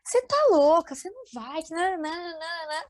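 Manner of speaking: pai imitado, agudo, começa alto e depois cai; caçoa da bronca do pai; mock stern father. Pai proíbe a viagem da filha. (imitando o pai que proíbe a viagem, agudo e arrastado)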